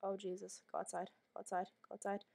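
ordinary talk, nothing marked

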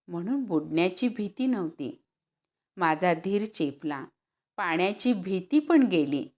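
usual speaking voice

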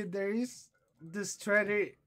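ordinary talk, nothing marked

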